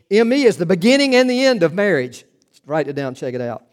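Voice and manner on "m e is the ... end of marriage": half-hearted grand declaration, louder; mock proclamation. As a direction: proclaim it like a self-loving bride. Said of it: "she says".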